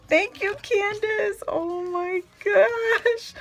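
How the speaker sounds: high, loud, near-tearful gush; overwhelmed thanks. Real, not acted. Thanking the sender on camera, high near-tearful gush. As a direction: thank her like it almost makes you cry.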